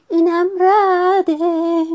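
high, loud, drawn-out wail; frightened cry. A narrator's reading. Franklin scared by the thunder, high frightened cry. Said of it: "فرانکلین دوباره ترسید".

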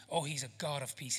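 usual speaking voice

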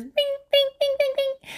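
high, repeated monotone pings; imitated heart-monitor beeps; monitor pinging. Picturing her voice as a heartbeat trace, high pings.

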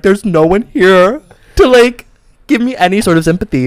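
milk the self-pity; plaintive, hammed-up sick patient; dramatic self-pity. Acts out feeling sick and alone.

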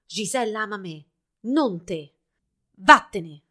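firm retort building to a loud dismissal; snapping. A narrator's reading. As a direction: send your rival away angrily. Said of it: "scattò Albert".

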